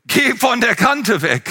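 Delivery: the dead mother's voice, called out; shouted warning. Retold or imitated. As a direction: call out the warning slowly. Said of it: "ich habe ihre Stimme gehört".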